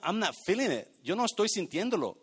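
normal talking voice

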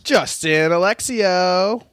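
showy self-introduction. Pretend. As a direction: stretch your name out like a ring announcer. Introducing himself on cue.

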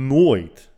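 nooit punched out loud and high after a pause; stress on nooit. Real, not acted. Telling viewers he never saw trouble, stressing nooit. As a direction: hit the word nooit hard.

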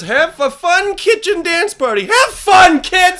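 hammed-up dad yelling, high and loud; psychopath dad. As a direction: order the kids to enjoy it, building to a shout. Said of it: "Just a psychopath dad".

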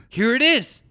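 high and loud, proud discovery; excited find. Retold or imitated. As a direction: show it off like a treasure. Friend excitedly finds the movie.

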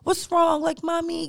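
put-on a small boy checking on mommy; concerned little son. Her son when she fakes crying, a worried little child voice. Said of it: "he gets very concerned".

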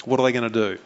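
ordinary talk, nothing marked

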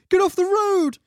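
high urgent shout; loud high urgent warning; Gandalf voice. Retold or imitated. Gandalf voiced in film scene.

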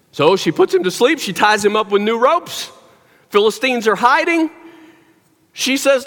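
ordinary talk, nothing marked

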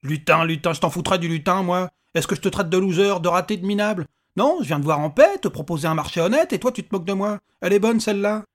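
puffed-up little man's furious tirade; indignant anger. A narrator's reading. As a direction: rail at being insulted. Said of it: "prendre sa colère au sérieux".

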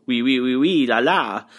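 light, teasing imitation of a France French accent; lightly mock the France French accent with oui oui la la. Podcaster mocking France French to contrast his Acadian accent, light France French accent imitation. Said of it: "not a France type of French".

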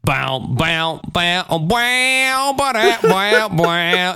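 wailing guitar riff sung as nonsense noises; mock slide guitar. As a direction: imitate a corny country music cue. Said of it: "It opens up with".

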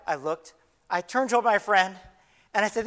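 normal talking voice

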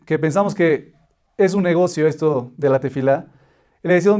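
usual speaking voice

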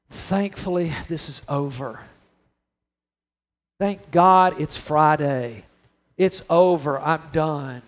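low, weary, relieved sigh of it; desperate relief. Retold or imitated. Jesus imagined as weary, not triumphant. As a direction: sound worn out and glad it's over. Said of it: "his final desperation to say".

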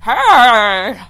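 loud, drawn-out skeleton cry. Shown as a demonstration. Host showing off her skeleton impression, loud skeleton voice. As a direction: belt it out as a silly skeleton. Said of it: "Skeleton, skeleton voice".

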